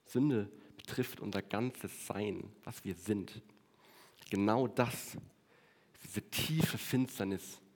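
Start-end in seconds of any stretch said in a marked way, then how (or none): 2.6-3.4 softly repeated. Acted. Repeats phrase, dropping low and soft.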